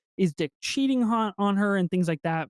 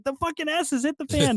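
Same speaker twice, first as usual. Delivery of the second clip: frustrated outburst; 8 semitones higher than usual. Retold or imitated. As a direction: pitch jumps high, exasperated exclamation. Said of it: "Earl's at work and has no idea".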